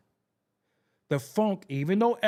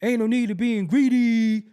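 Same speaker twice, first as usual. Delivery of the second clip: [name] dragging vocals; 5 semitones higher, 7 dB louder than usual. Retold or imitated. Recalling [name]'s studio habit, dragging the line. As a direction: drag the words out like [name]. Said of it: "I remember [name] dragging his vocals".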